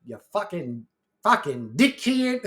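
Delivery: fucking dickhead drawled slowly in a mock Australian accent; slow mock Australian curse, dickhead stretched; mock Australian insult. Streamer imitating Australians cursing, slow mock Australian accent. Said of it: "That shit's funny sounding".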